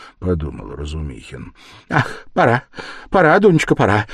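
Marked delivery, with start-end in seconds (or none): 1.9-4.1 higher, hurried, anxious repeats; mother flustered, voice raised; anxious fussing. Acted. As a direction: fret and fuss to hurry off. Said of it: "тревожно засуетилась".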